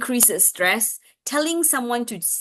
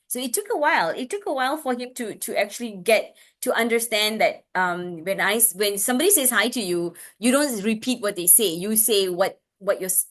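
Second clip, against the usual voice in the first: ordinary talk, nothing marked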